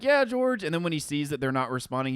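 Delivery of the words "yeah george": much higher, louder cooing to a pet; sing-song talk to a goose; imitating Bob to his goose. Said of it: "he'll be like".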